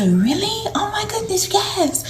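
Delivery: high, soft delighted gasp, mostly whispered. Retold or imitated. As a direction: breathe it out in hushed delight. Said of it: "and she's like".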